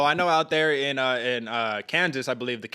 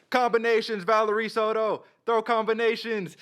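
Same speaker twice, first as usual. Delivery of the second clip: imitated corner scream; 8 semitones higher than usual. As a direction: imitate a cornerman calling instructions from ringside. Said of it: "he's just screaming your full name".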